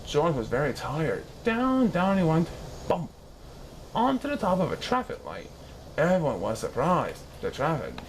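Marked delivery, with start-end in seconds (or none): none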